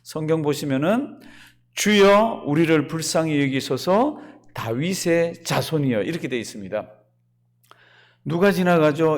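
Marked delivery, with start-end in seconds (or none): none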